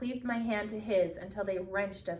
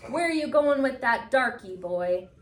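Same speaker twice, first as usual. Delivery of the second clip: louder, higher hostile yell; 4 semitones higher, 7 dB louder than usual. A narrator's reading. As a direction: shout him down like a bully. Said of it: "one yelled at me".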